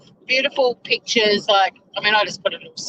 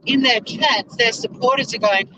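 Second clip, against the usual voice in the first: usual speaking voice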